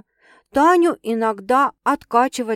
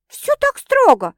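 much higher, louder and slow disbelief; can't believe it's that strict; incredulous; 9 dB louder, slower than usual. A narrator's reading. Sveta asking her sister in disbelief, high and slow. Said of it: "недоверчиво уточнила".

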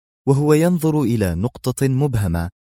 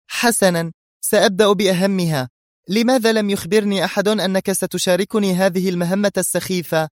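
high-pitched indignant character voice; angry woman; 9 semitones higher than usual. A narrator's reading. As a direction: press the complaint in annoyance. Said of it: "قالت منى في غضب". (غضب نسائي بنبرة عالية)

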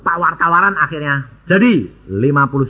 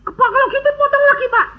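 imitating the seller, much higher and fast; eager seller; faster than usual. Seller offering the preacher another discount, high and fast. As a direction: rush the offer, pitched up.

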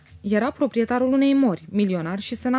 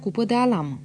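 plain reading voice

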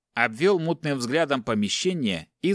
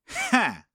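short laugh; 12 semitones higher than usual. Acted. Captor laughs.

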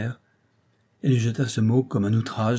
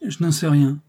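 "Je n'en sais rien" said again, pressed and raised after a silence; after a long silence; 6 semitones higher, 6 dB louder than usual. A narrator's reading. Juste to Rose, after a long silence, repeats himself, raised. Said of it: "reprit-il après un silence".

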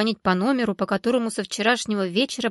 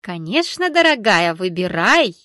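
loud, sweetly coaxing vendor; butter her up, all smiles; sugary coaxing; 8 dB louder than usual. A narrator's reading. Vendor fawning over the customer, loud sugary coaxing. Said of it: "приговаривая".

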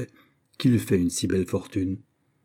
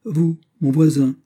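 Vous, mon voisin, lifted higher and louder; surprised; 7 semitones higher, 6 dB louder than usual. A narrator's reading. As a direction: caught off guard by the news. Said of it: "non sans une certaine surprise".

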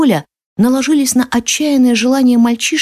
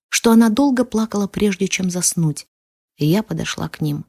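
ordinary delivery

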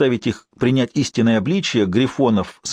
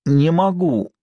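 higher, straining groan, slowing at the end; exhausted, pained cry of giving up; strained moan; 7 semitones higher, slower than usual. Acted. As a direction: moan it as if at the end of his strength. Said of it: "простонал Шер".

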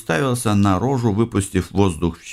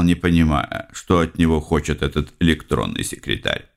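ordinary delivery

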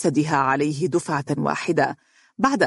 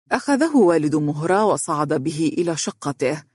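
ordinary delivery

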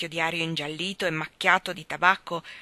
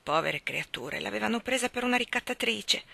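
plain reading voice

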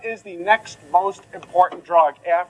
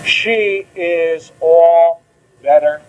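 drawn out; 5 dB louder, much slower than usual. Shown as a demonstration. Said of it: "drawn out relief".

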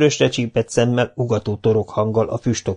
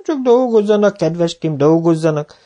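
high, mock-innocent old villager; sly old man; 10 semitones higher, 4 dB louder than usual. Old Zsibora brushing off the foreman, sly and high. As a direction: shrug it off slyly.

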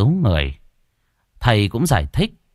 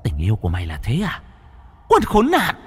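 soft, contemptuous spirit voice rising to a high curse; vengeful ghost scorning her unfaithful lover; bitter ghost; 5 semitones higher, 5 dB quieter than usual. Acted. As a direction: spit scorn at the man who betrayed her. Ghost berates faithless lover.

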